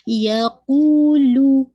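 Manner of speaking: यकूलु with कू held for two counts; Quranic recitation. Shown as a demonstration. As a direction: stretch the कू, keep लू short. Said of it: "दाल पे आपने वाजे करना है". (तजवीद के साथ यकूलु)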